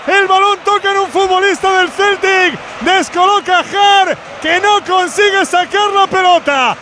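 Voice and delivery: high, urgent goal recap; excited commentary. Real, not acted. Post-goal commentary, raised.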